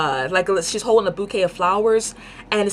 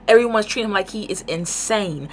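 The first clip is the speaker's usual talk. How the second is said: ordinary delivery, nothing marked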